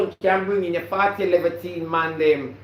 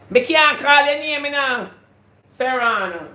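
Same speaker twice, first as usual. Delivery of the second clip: high, loud outburst of disbelief; emphatic exclaim; 8 semitones higher, 6 dB louder than usual. Real, not acted. Vlogger exclaiming loudly to her viewers. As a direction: cry out that she can't say it. Exclaiming she cannot say names.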